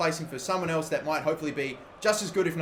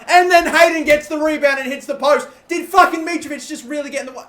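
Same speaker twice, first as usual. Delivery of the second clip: real rising yell of disbelief, higher and louder; frustrated outcry; 10 semitones higher, 12 dB louder than usual. Real, not acted. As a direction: cry out as the chance is wasted. Chance blocked, hits post.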